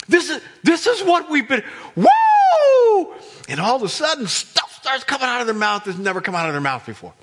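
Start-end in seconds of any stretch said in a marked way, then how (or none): 0.1-1.6 high, loud dawning excitement; excited realization. Acted. Disciple realizing the wait is over, thrilled. 2.0-3.1 very high, loud sustained whoop; elated whoop. Acted. Disciple as the Spirit arrives, whooping.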